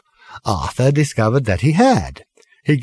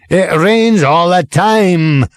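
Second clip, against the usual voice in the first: slow, louder, emphatic rave; raving; 7 semitones higher, 4 dB louder, much slower than usual. A narrator's reading. Lorry driver raving at Arthur, thumping the table. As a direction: pound each word like thumping the table. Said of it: "raved the man".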